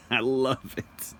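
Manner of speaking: high, drawn-out words through a laugh; laugh, then savor it slowly, still amused; laughing with delight. Real, not acted. Speaker relishing his favorite movie quote, laughing delightedly.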